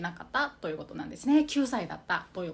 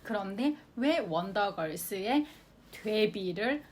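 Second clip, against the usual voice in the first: normal talking voice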